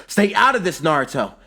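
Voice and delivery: high, loud warning yell; heated, high-pitched yell. A narrator's reading. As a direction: shout him away forcefully. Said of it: "then he yells".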